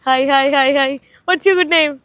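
bright, high and loud, hi hi hi; excited greeting. Real, not acted.

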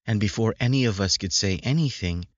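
plain reading voice

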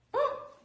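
a sharp high gasp of outrage; shocked gasp. Retold or imitated. Hearing the sponsor blame her, a shocked gasp. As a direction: gasp as if insulted.

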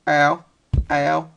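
ordinary talk, nothing marked

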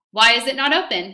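loud, sharp officer's demand. Acted. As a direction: push the question hard at her. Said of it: "he demanded".

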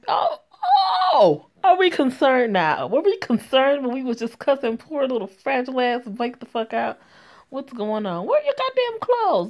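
high, loud incredulous scolding; mock outrage. Real, not acted. Reader scolds character's sudden concern.

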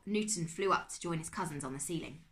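ordinary delivery, nothing marked

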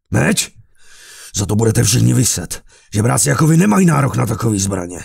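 shouting indignant threats; angry threat. A narrator's reading. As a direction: threaten them, furious. Carlos threatens armed beggars.

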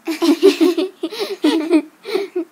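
long, loud giggle; giggling. Acted. Friends amused after a spooky story, giggling. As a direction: burst of giggles tailing into chuckles.

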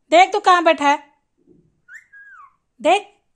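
loud call across the room, looking for the bird. Real, not acted. Owner calling loudly to the parrot, loud call. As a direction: raise your voice to find him.

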